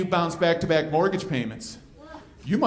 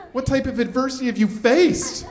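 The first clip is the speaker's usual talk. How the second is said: mocking; 5 semitones higher, 3 dB louder than usual. Real, not acted. Mocking the young friend's adversity.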